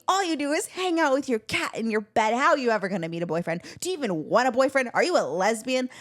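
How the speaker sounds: mock mother going in on her; imitating Mom. Mom scolding her daughter about boyfriends, high nagging. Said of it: "literally goes in on me".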